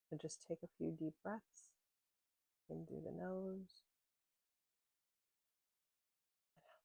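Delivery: calm, unhurried voice with long pauses; gentle grounding-practice guide; guided meditation. Real, not acted. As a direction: ease listeners into their senses, letting phrases settle and fade.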